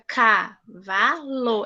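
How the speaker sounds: "cavalo" spoken very slowly in three open beats; syllable by syllable. Shown as a demonstration. Teacher showing the class three mouth openings. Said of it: "quando eu abro a minha boca".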